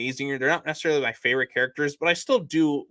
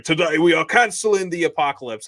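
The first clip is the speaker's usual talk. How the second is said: booming commander's rallying speech; Idris Elba speech; 4 semitones higher, 5 dB louder than usual. Retold or imitated. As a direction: deliver it like a battlefield speech. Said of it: "and so he's like".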